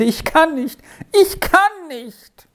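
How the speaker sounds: very high, drawn-out anguished cry; groaning wail. A narrator's reading. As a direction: wail it out, stretching the words. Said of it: "ächzte und stöhnte".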